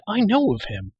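high, loud, heated insistence; indignant young outburst; disbelieving cry. A narrator's reading. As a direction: cry out in protest, voice jumping up. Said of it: "he cried".

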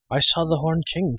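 high-pitched urgent outburst; breaking news in a rush of alarm; bursting out. A narrator's reading. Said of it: "Taran burst out".

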